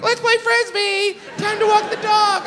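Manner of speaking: high, chirpy cheer; cheery local. Retold or imitated. Vegas resident cheerfully ignoring the heat, high and chirpy. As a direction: sing it out like a sunny day. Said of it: "you were like".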